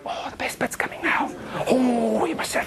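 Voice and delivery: hammed-up high whispering in a neighbour's ear; whispering moviegoer. Cinema-goer pestering the person next to them in a hammed-up whisper. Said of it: "the person next to you is like".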